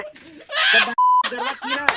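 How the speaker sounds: breaking into laughter mid-complaint; can't hold the laugh. Real, not acted. Caller cracking up while scolding friend, laughing.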